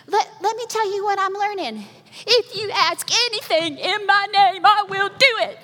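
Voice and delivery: put-on high, loud child reciting a Bible verse; be a little Southern girl showing off her memory verse; proud little girl reciting. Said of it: "she has very Alabama Southern accent".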